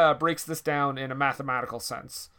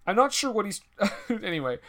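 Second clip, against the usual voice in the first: ordinary talk, nothing marked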